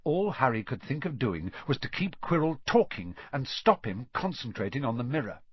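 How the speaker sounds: plain reading voice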